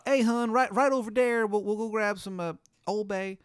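put-on high, loud Baltimore accent calling hun; Maryland accent. Palace staff greeting the king in a Maryland accent. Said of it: "They'll have Maryland accents".

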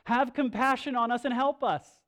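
loud, high cry for help; father begging loudly for his son; desperate plea. Retold or imitated. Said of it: "he comes to Jesus and he says".

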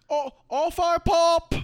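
very high, loud, drawn-out mock British; British accent. Actor leaving the wrap party, hammed-up British accent. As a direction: chirp it out in a silly British accent. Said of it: "never do the accent again".

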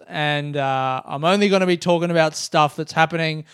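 ordinary talk

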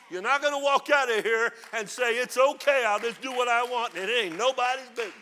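loud, high pulpit shout; preaching at full force; shouting preacher. Acted. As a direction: shout the truth at the congregation.

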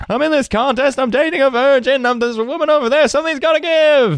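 very high-pitched frantic rant; Jerry freak-out. Acted. Sitcom character rants to his friend about the contest, high-pitched freak-out. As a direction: build into a squealed, exasperated comic meltdown. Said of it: "great Jerry freak out moment".

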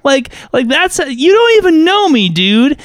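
high, indignant, slowed rant; mock outrage. Imagined reply to the pushy game master, high indignant rant. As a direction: rant back, high and offended. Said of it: "I am like".

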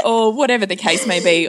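ordinary talk

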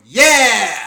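loud, high whoop; imitated child cheering. Four-year-old cheers dad's threat from car seat, imitated cheering. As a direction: mimic a little kid's gleeful cheer.

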